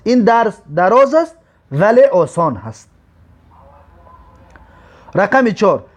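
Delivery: ordinary talk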